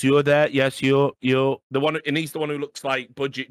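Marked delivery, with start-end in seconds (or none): 0.0-1.6 loud, insistent mock producer; mock official singling someone out; imitating ESL producer. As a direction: call someone out, hammed up. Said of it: "the E S L guy goes".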